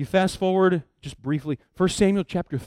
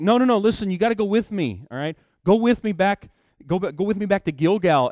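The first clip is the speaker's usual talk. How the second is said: louder, pleading Saul, begging him not to leave; urgent pleading; 5 semitones higher, 5 dB louder than usual. Retold or imitated. Saul pleading with Samuel to come back, loud and urgent. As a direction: press him hard, no no no, don't go.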